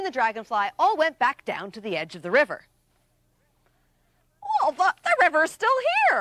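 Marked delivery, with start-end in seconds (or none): 4.6-6.2 a little higher and quick; relieved chipmunk. Acted. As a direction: sigh of relief.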